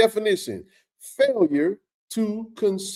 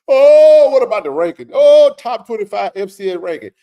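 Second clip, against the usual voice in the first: mock objection; 4 semitones higher, 10 dB louder than usual. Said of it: "mocking commenters' objection".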